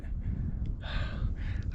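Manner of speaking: long winded exhale; heavy sigh. Real, not acted. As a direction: blow out a tired breath. Catching breath mid-race.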